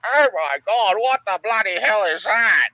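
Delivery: hammed-up stunned old man; mock shocked old bowler. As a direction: voice a scandalised old bowls player. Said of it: "old farts playing bowls going".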